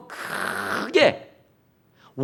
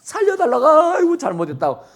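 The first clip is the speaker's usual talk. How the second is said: high, pleading cry of a trembling woman; frightened pleading; 8 semitones higher than usual. Retold or imitated. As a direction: beg for mercy in a rising wail. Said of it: "벌벌벌벌 떨면서".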